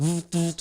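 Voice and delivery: short bursts of vibration noise; imitated electric buzzing. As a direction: buzz like little electric shocks. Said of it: "You know, it's like".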